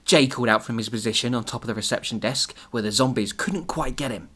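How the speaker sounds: ordinary talk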